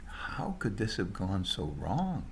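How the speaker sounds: ordinary delivery, nothing marked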